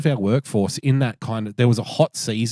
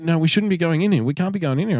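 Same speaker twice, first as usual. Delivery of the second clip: higher, a bit quick, imitating a jittery worrier; mock nervous backpacker; 6 semitones higher, faster than usual. Retelling the worried Dutch workmate at the gate, higher mock nervous whine. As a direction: lightly play a panicky newbie refusing.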